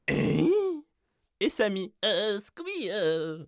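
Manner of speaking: high, yelping cartoon dog voice; Scooby-Doo impression. Shown as a demonstration. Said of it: "Ou imiter Scooby-Doo".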